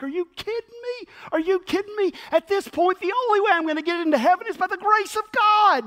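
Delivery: high exasperated outburst; frustrated. Acted. Frustrated man protesting to the angel, pitched high. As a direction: throw up your hands in disbelief. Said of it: "throws up his hands in frustration".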